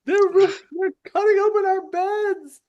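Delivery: loud, very high squealed shout; panicking kobold. Acted. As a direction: cry for help in squeaky falsetto. Kobolds cry out for help.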